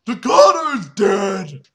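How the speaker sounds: slow, loud, high shout. Acted. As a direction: announce it as a triumphant yell. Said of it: "He said!".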